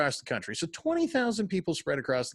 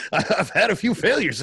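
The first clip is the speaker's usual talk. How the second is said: laughing; 5 semitones higher, 6 dB louder than usual. Real, not acted. Joking about his own failures.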